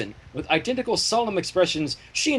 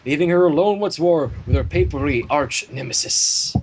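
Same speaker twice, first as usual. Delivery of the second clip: ordinary delivery, nothing marked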